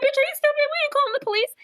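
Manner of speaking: mock her friend with a shrill sneer; bossy mean girl. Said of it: "Courtney's like".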